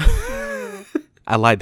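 high, laughing admission; laughing confession. Real, not acted. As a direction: giggle the confession out. Punchline after joke setup.

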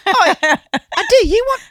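squeaky high pitch. Pretend. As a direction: push it up high. High mock voice.

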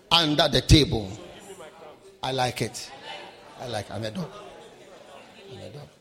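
low, soft, self-mocking "I'm a dog"; mock humble woman. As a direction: play the lowly woman meekly. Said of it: "Syrophoenician woman said".

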